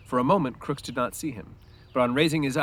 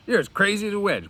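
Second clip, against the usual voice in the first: mocking Crooks; 10 semitones higher, 5 dB louder than usual. A narrator's reading.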